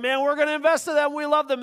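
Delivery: high, fast, over-eager public praise; mocking the sending church's public promises; mock pulpit promise. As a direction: ham up the hollow enthusiasm. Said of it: "from the pulpit was like".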